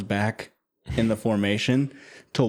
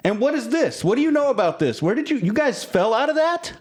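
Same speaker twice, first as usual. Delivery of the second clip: high-pitched Conrad voice; 12 semitones higher, faster than usual. Acted. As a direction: voice of a startled, suspicious dad. Conrad confronts the party.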